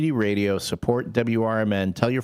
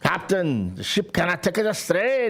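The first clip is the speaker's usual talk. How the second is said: put-on high, strained Scottish accent; bad imitation of a Scottish ship's engineer; Scotty; 7 semitones higher than usual. Host imitating an engineer warning the captain, Scottish accent.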